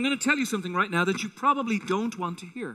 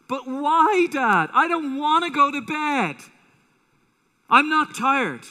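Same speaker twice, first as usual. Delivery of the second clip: put-on high, louder childish protest; whiny child protesting; 6 semitones higher, 4 dB louder, slower than usual. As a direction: play a kid refusing bedtime. Child answers father calling to rest.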